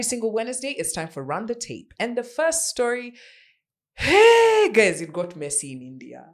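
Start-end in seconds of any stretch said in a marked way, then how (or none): 3.9-5.0 high, loud call of hey; shout-out greeting to the viewers. Real, not acted. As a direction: hail the audience brightly. Calling out to open story.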